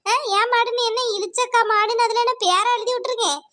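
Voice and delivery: ordinary talk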